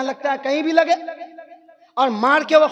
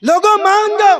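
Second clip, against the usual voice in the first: higher, louder pleading cry to the crowd; चीखता हुआ आलिम; 7 semitones higher, 7 dB louder than usual. Retold or imitated. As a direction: shout it out like a preacher begging people. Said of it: "कैसे चीख कर गए". (ऊंची आवाज़ में चीखकर पुकारना)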